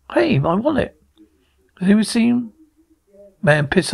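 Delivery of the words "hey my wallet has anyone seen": high, urgent call for help; alarmed calling out. Acted. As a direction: shout out in sudden panic. Man discovers his wallet missing.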